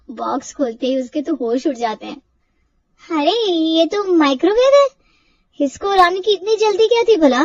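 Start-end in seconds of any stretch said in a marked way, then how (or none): 3.1-7.4 higher, louder startled exclamation; shocked surprise. Acted. Wife stunned to find a microwave, loud and surprised. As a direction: gasp it out in disbelief.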